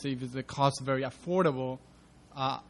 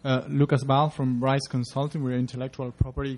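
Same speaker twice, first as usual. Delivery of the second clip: repeats his name louder, right into the mic; louder, closer to mic; 8 dB louder than usual. Real, not acted. Moderator asks him closer to the mic, so louder introduction. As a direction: speak up so the room hears you. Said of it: "Closer to the mic".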